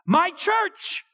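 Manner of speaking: put-on high, loud happy shout of a small boy; gleeful toddler yelling at a logo; excited child's yell. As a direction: call it out like a thrilled little kid. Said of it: "he yells".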